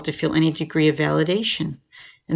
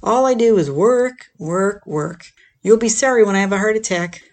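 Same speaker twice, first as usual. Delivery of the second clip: playing the victim; 4 semitones higher than usual. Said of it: "playing the role of the victim".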